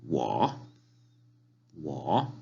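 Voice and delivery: "Wo" sinking low, then climbing; third tone. Shown as a demonstration. Teacher naming the character for yo, dipping tone. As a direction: bend each "Wo" down and back up. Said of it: "Es un tono de abajo hacia arriba".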